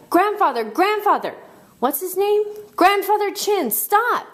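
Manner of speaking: high, urgent group-style shouts; shouting. A narrator's reading. Boy and friends join calling grandfather, high urgent shouting. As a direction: yell up to someone far above. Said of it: "started shouting".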